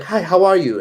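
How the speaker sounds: high, loud, sing-song imitation of a pushy street vendor; henna lady greeting. Imitating the henna lady calling out to a tourist, high and loud. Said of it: "she was like".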